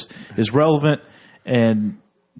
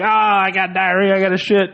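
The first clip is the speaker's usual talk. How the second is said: mock cartoon-boy complaint; Cartman; 8 semitones higher than usual. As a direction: put on a whiny cartoon kid announcing an emergency. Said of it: "And Cartman does. He's like".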